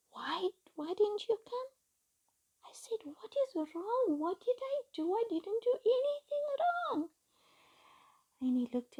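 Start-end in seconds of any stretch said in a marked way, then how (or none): 0.1-7.1 much higher, anxious pleading. Retold or imitated. Wife pleading with her husband, higher and distressed. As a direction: beg him to say what she did wrong.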